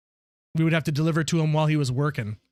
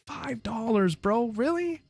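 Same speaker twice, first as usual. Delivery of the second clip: high-pitched incredulous whine, trailing softer; mock disbelief; 8 semitones higher, 5 dB quieter, slower than usual. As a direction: act stunned by a tiny tip. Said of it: "I'm like".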